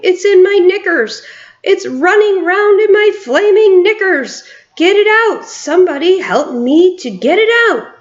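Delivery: high, loud frantic wail; screaming. A narrator's reading. Cook screaming to the kitchen staff, high loud panic. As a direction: hysterical cook begging for help. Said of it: "the man was screaming".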